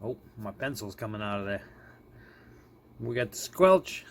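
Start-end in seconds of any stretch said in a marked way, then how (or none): none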